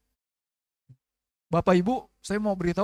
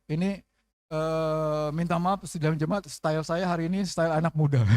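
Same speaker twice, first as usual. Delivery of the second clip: ordinary delivery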